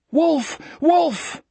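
very high cry of alarm; anguished cry. A narrator's reading. Cries out as friend is clubbed.

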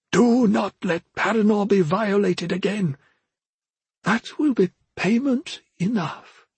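high, slow parting charge from a spirit; solemn ghostly mentor. Acted. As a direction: intone a last command, drawn out and high.

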